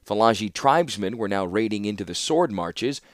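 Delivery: usual speaking voice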